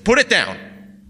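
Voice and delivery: loud, high, slow scolding; weary irritability. A narrator's reading. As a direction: drag out an irritated order. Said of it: "with a weary irritability".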